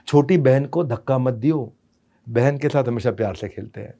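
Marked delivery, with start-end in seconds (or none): 2.3-3.9 gentle instruction. Shown as a demonstration.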